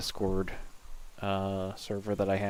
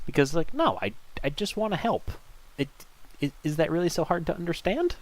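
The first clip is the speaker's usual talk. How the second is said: mock indignant hero; 6 semitones higher than usual. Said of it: "always a great motivator".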